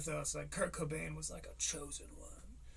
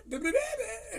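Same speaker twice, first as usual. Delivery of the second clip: much higher squeaky babble; mock kid babble. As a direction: squeal it like a small child. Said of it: "I was like".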